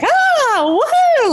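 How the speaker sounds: high, gleeful woohoo; mock whoop of joy. Remembering carefree years, a high joyful whoop. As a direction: whoop it up like life is great.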